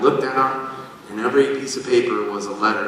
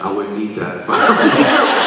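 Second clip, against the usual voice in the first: talking goat; 4 semitones lower, 5 dB louder than usual. Pretend.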